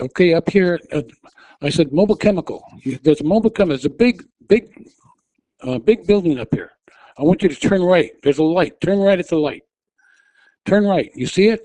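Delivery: high, loud, insistent retold commands; urgent directions. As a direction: drive each instruction home at him.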